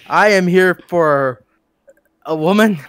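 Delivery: slow, unsure rising question; questioning tune. Acted. Friend mocks him for saying he wants a woman as a question. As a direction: say it like you're asking, not stating. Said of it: "why'd you say it with a question mark?".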